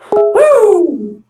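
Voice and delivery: high siren whoop sung out; mock siren. Kid telling the cops arrived, mock siren whoop. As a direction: imitate a police siren's woo.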